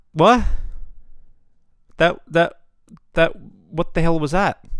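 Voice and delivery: stammering, loud, bewildered; stunned, can't find the words; baffled disbelief. Retold or imitated. Retold disbelief at the ending.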